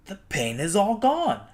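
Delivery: a crocodile astonished his pain is gone; relieved crocodile. A narrator's reading. Crocodile relieved after tooth pulled.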